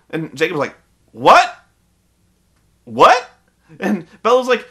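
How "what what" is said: loud, high, rising mock disbelief; hammed-up double take; Jacob stunned. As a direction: ask it sharply, voice jumping up. Jacob stunned by love confession.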